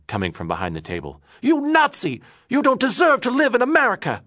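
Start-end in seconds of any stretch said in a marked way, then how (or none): 1.4-4.2 very high, loud furious shout; angry shout. Acted. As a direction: scream him out of the country. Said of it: "Morris shouted angrily".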